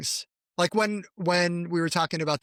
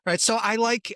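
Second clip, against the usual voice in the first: ordinary delivery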